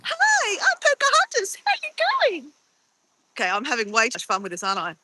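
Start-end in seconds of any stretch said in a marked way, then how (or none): 0.0-2.5 high, mock-sweet princess greeting; high playful Pocahontas intro. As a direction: chirp it like a cartoon princess. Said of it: "I look like Pocahontas".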